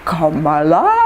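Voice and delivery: mock puzzled "Kamala?" with a rising question; as if the name rings no bell, lifting at the end. [name] pretends not to know her, a mock puzzled question. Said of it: "like that".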